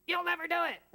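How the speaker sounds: high taunting liar; high mocking inner liar. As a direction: sneer it like the doubting liar. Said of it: "a liar in our head".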